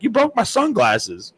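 high, slow, whiny complaint; aggrieved victim. Retold or imitated. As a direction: whine it like the beaten guy. Beaten guy complains.

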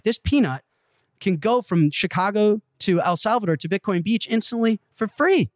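high-pitched, hyped-up retold pitch; excited sales pitch. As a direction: build to a triumphant, high punch at the end. Re-enacts pitch, answering baffled listeners.

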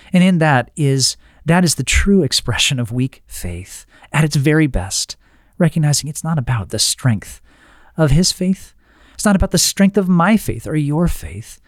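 normal talking voice

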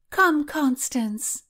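high, slow coaxing court lady; Mary's voice. Acted. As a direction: beckon her in a lilting high voice. Narrator voices Mary.

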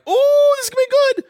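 excited. Retold or imitated. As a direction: burst of eager anticipation. Mimics hyped first impression.

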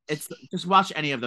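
ordinary talk

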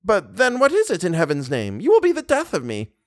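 put-on high, agitated old lady; exasperated old mother. Old lady begs for an explanation, high and agitated. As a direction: protest high, quite overwrought. Voices Mamma Valerius.

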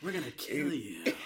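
low, soft deadpan threat with a laugh; badly acted wooden menace; flat mock menace. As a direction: say it cold and wooden, barely holding the laugh. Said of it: "as he acts this out".

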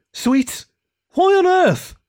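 very high, baffled questioning; incredulous. Acted. Skunk puzzles over the falling sweets, incredulous. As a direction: climb to a loud squeak of disbelief.

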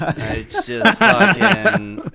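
slow words broken by laughter; laughing. Real, not acted. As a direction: laugh through it. Laughing at friend's nerd joke.

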